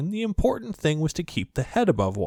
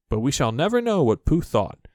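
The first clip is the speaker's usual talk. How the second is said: plain reading voice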